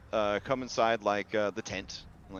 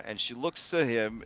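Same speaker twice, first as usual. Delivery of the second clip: ordinary delivery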